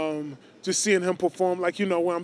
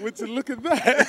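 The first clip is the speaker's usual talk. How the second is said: higher, louder feigned wonder; hammed-up surprise, playing along; mock amazement; 6 semitones higher, 5 dB louder than usual. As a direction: marvel at a staged coincidence. Playing along with staged coincidence.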